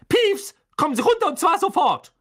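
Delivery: high, loud strict schoolmistress voice; angry stern teacher. Acted. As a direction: order him down at once, furious. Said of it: "rief eine zornige Stimme". (strenge Lehrerin, hoch und laut befehlend)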